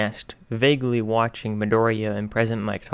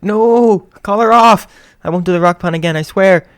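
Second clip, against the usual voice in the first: much higher, loud frantic pleading; panicked shout; 9 semitones higher, 11 dB louder than usual. Acted. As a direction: yell for mercy. Attacked by bird, pleading.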